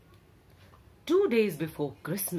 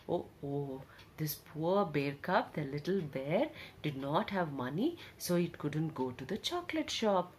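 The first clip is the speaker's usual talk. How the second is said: pitying; 3 semitones lower, 6 dB quieter than usual. Acted. Pities the poor cub.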